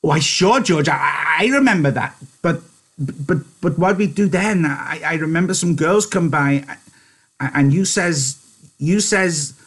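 high, excited stammering; delighted. Acted. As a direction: stumble over the words with childlike delight, voice up. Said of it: "Lenny's face broke into a delighted smile".

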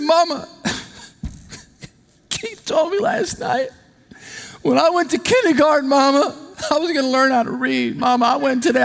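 high, whiny little boy mid-sob, lightly hammed; mock crying child. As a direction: whimper it like a crushed kindergartner.

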